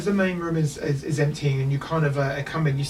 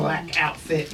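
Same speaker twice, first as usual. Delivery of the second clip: normal talking voice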